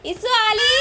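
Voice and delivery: high calling voice. Real, not acted. Mother calling the others for her crying baby, high call.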